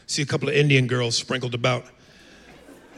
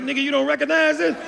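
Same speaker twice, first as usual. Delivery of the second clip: indignant retort; 13 semitones higher than usual. Retold or imitated. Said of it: "I was like".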